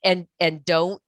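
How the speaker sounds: usual speaking voice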